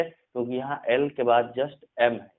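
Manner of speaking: usual speaking voice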